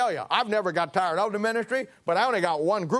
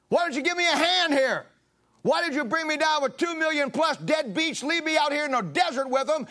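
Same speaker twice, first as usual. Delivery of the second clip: higher, raised, weary complaint; fed-up pleading; 7 semitones higher than usual. Acted. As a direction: beg for help like a man at his limit. Moses begging God for help.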